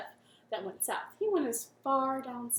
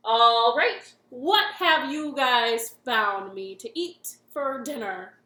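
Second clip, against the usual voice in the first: grumpy king; 11 dB louder, slower than usual. Acted. Grumpy king demands dinner.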